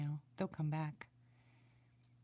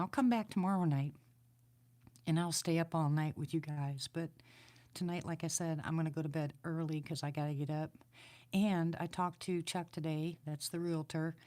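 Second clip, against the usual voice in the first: ordinary talk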